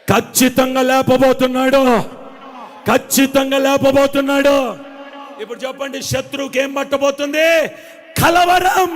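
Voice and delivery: loud, high fervent preaching cry; preaching at full force. Acted. Preacher to his congregation, shouting with full fervour. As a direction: rouse the congregation with rising shouts.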